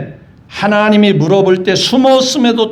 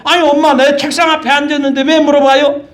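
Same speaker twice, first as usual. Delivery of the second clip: put-on higher, protesting kid's voice; whiny child; 8 semitones higher than usual. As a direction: play a cheeky child talking back. Child talks back to mother. (높고 투정 부리는 아이 목소리)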